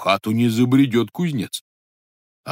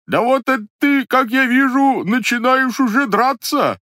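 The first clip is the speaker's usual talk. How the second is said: high, louder put-on voice; pitched-up stranger, startled and hurt; disguised voice, alarmed; 6 dB louder than usual. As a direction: cry out in the fake voice as the blow lands. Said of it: "переменив голос".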